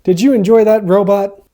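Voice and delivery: ordinary delivery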